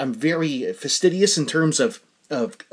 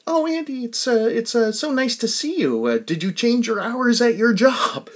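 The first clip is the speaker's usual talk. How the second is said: higher-pitched friendly neighbour imitation; older woman neighbour; 8 semitones higher than usual. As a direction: put on a higher voice for an older woman greeting someone. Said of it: "she said, uh".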